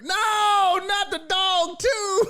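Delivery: mock outrage. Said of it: "I was like".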